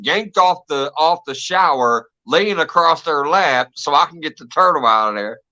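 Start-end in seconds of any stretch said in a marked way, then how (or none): none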